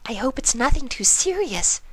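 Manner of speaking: a worried shy pony; timid pony. Acted. Narrator voices shy Fluttershy.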